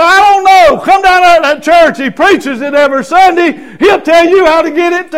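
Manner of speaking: high, loud, bursting with joy; telling coworkers to come to church, all fired up; excited shout. Retold or imitated. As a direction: shout it out to everyone at work.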